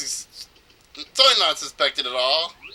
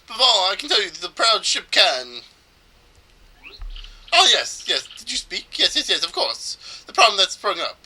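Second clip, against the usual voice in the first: put-on high, theatrical Spanish-accented boast; badly done Spanish accent, pitched up; 5 semitones higher than usual. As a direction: show off grandly in a loose Spanish accent. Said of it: "I think it's supposed to be Spanish".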